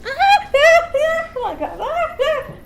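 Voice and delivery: high, slow, shrieking yelps at a wasp; mock panicked yelp. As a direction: act out a girl squealing in panic at a wasp. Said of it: "somebody screaming and yelping".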